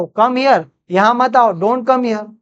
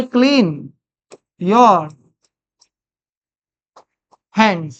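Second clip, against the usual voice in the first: long pauses between words; word-by-word dictation; 3 semitones lower, much slower than usual. Shown as a demonstration. Teacher writing out an English translation, word-by-word dictation. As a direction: dictate slowly, one word at a time.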